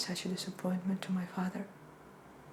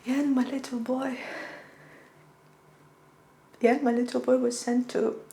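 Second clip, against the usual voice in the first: raised pitch, anguish held in; grieving mother; 4 semitones higher, 4 dB louder than usual. Acted. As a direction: recall your lost little boy with rising distress. Mother recalls losing her son.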